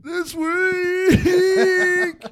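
high, loud drawn-out winner call; fighting game announcer. Pretend. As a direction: belt out the champion's name. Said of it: "Pretty good announcer voice".

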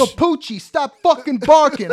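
hammed-up East Coast yell; East Coast owner. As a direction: holler at the dog, high and loud, East Coast style. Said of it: "But East Coast dogs are".

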